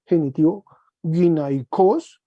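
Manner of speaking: ordinary talk, nothing marked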